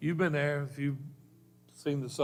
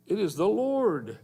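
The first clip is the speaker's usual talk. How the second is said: higher, louder, slow exclamation; excited recognition; 7 semitones higher, 6 dB louder, slower than usual. Acted. The beloved disciple tells Peter, raised and awed. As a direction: announce it with wonder.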